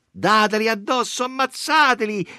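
high, loud incitement; venomous yelling. A narrator's reading. Bitterest women urging attack from behind, high loud yelling. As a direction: egg the mob on furiously. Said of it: "ci riogavano di dietro quelle più avvelenate".

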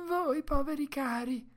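high, pitying woman's wail; tearful Mercedes. A narrator's reading. Woman hugging the exhausted dog, high tearful commiseration. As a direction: lament high over the poor creatures. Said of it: "piangeva con commiserazione".

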